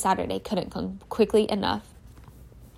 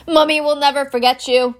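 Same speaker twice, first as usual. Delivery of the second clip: higher, louder mock-tearful farewell; tearful Hagrid; 5 semitones higher, 6 dB louder than usual. Hagrid saying a tearful goodbye to his baby dragon, higher and louder.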